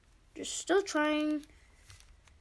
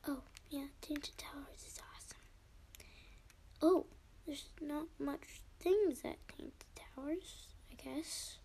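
whispering; 8 dB quieter than usual. Real, not acted. Sudden whispering mid-game.

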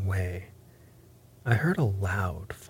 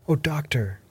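crying out; 6 semitones higher than usual. A narrator's reading. Mother and son greeting the arriving doctor, higher anxious cry. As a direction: cry out lightly for help. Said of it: "we cried".

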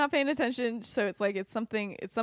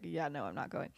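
low, soft retold refusal; muted refusal; 6 semitones lower, 8 dB quieter than usual. Lily refusing after finishing the letter, muted. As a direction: say it softly, dropped in pitch.

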